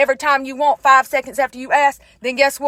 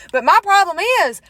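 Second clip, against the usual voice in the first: normal talking voice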